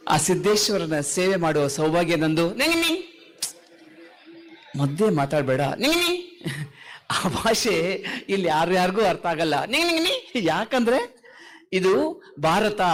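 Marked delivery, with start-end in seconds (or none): 2.5-3.0 high squeaky puppet babble cutting in; puppet gibberish. Acted. Ventriloquist's puppet butting in with high gibberish. 5.6-6.2 high squeaky puppet babble cutting in; puppet gibberish. Acted. Ventriloquist's puppet butting in with high gibberish. 9.6-10.5 high squeaky puppet babble cutting in; puppet gibberish. Acted. Ventriloquist's puppet butting in with high gibberish.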